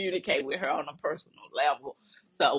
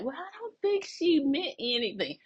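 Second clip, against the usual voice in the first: higher, lightly mimicked brush-off; light dismissive imitation; 9 semitones higher than usual. Imitating coworker Degante brushing it off, higher mock voice. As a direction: play down the incident, mock-casual. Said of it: "And Degante was like".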